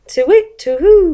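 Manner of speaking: high, loud two-part hoot; owl hoot. A narrator's reading. Owl in the garden at night, hooting high. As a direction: call out like an owl. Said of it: "went the owl".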